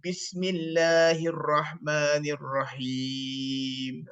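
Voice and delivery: very slow, low recitation on one long outbreath; low drawn-out chant, rising at the end. Shown as a demonstration. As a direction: stretch every syllable as you breathe out. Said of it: "you breathe out slowly".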